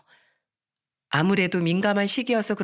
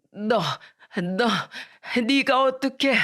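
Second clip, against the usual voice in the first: halting words, rising in pitch; shocked grandmother; 4 semitones higher, slower than usual. Acted. Grandmother reeling from cruel words, stammering in shock. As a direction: break off in stunned disbelief. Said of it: "미란이 말에 충격을 받고".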